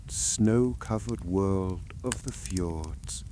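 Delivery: ordinary delivery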